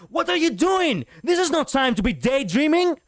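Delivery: high, loud, frustrated shout; general's command full of anger and frustration; panicked, angry command. Shown as a demonstration. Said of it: "It should have gone like this".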